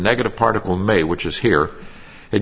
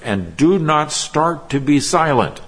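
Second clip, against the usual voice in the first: "do not" hit firmly, slow and raised; emphatic do not; 6 semitones higher than usual. Shown as a demonstration. Preacher showing the Greek emphasis to his congregation, slow emphatic stress. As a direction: lean on "do not" so it lands as a command. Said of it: "You get the emphasis here".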